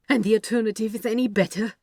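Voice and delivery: fast, loud, strained outburst; too loud, stressed. A narrator's reading. Angel defends his risky plan to Crowley, fast loud strain. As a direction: snap back, voice tight with stress. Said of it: "a little too loudly, a little too stressed".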